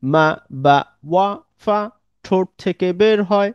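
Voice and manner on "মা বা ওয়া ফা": "মা বা ওয়া ফা" each sounded crisply from the lips; lip letters sounded out. Shown as a demonstration. Teacher shows students the letters made at the lips, sounding each out. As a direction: press the lips on every letter. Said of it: "আমরা একটু একবার পড়ে নিতে পারি".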